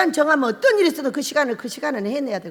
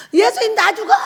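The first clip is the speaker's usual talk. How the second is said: much higher, louder panicked cry; mock panic; 9 semitones higher, 8 dB louder than usual. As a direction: Peter sinking, crying for help. Peter sinking in waves.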